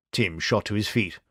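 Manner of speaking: ordinary delivery, nothing marked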